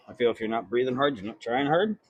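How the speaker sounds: normal talking voice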